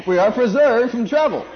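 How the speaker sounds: high and loud, fervent; rising preacher shout. Real, not acted. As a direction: lift it to a ringing shout.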